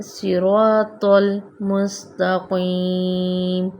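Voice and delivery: सिरातल with a thick heavy तौ, slow. Shown as a demonstration. Teacher recites sirat with the heavy ta. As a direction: make the तौ thick. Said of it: "ये दो नुक्तों वाला ता नहीं है".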